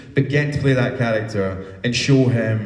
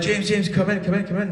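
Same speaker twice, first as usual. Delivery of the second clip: higher-pitched, eager beckoning; imitating an excited invitation to come over; eager calling; 9 semitones higher, faster than usual. Acting out elders calling him in.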